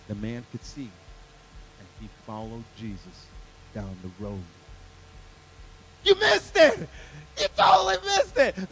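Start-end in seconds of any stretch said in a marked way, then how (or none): 6.0-8.7 high, loud mock outrage; excited, high-pitched cry of disbelief; loud exclamation. Real, not acted. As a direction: burst out at the crowd, pitched way up. Preacher exclaims congregation missed joke.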